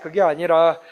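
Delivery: ordinary talk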